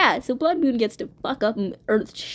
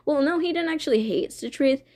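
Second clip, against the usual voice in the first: ordinary delivery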